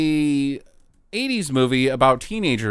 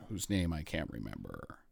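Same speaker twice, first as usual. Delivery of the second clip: normal talking voice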